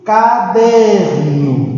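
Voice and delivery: "Caderno" very slow, long emphatic DER; tonic syllable stressed. Shown as a demonstration. Teacher shows where the tonic syllable is, stretching DER in "Caderno". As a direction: split "Caderno" slowly, landing hard on DER. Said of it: "a sílaba tônica está aqui".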